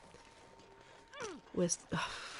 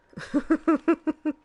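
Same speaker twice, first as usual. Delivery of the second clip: laughing; 11 semitones higher, 11 dB louder than usual. Real, not acted. Laughing at game mishap.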